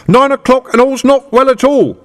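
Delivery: high, loud bell-ringer's cry; young constable running and crying the hour; shouting. Acted. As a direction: call it out at the top of your voice. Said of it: "ringing his bell madly and shouting".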